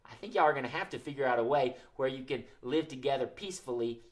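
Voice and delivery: normal talking voice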